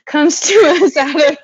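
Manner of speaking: laughing through the words. Real, not acted. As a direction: giggle as you restart the sentence. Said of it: "I'm a little hiccupy".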